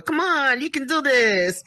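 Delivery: encouraging extrovert. Retold or imitated. Extrovert mother coaxing her introverted daughter, drawn-out encouragement. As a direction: cheer on someone shy, stretching it out. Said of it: "I've gotta be the extrovert".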